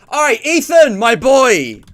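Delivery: high, loud hype greeting; excited hype. Real, not acted. Host hypes up his friend Ethan on stream, loud and high. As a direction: welcome your buddy like a hype man.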